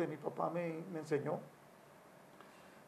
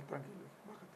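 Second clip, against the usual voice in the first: much softer, lower calming words; calm reassurance; 4 semitones lower, 16 dB quieter than usual. Retold or imitated. Father calming his son after the outburst, soft and low. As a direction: settle the boy down gently. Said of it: "ya hablé con él".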